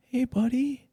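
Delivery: high-pitched imitation of a friendly pal; buddy's way of talking. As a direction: imitate your buddy greeting you, way up high. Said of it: "that's how he talks".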